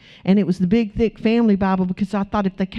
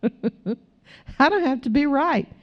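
higher and slow after a real chuckle; chuckle, then say it with amusement; real laugh leading into the words; 5 semitones higher, slower than usual. Real, not acted. Laughs, then repeats the line.

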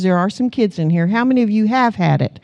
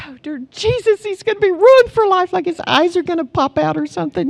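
higher mock panic, hammed up; retold panic; 8 semitones higher than usual. Mom panicking that her son sees topless women, mock alarm. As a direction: play the horrified mom, mildly. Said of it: "freaking out trying to get him".

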